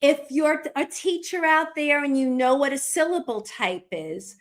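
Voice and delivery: ordinary talk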